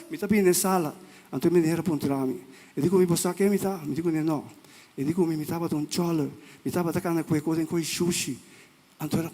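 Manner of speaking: ordinary talk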